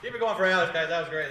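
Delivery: rally the room to clap; host hyping applause. Real, not acted. Host keeps applause going for the comic, loud hype.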